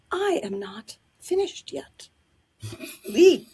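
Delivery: slow and cold, then a loud sharp leave; imperious diva. Retold or imitated. Diva ordering the volunteer off stage, cold then loud. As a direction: freeze her out, then bark the last word. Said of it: "And Miss Battle says".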